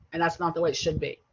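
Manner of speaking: usual speaking voice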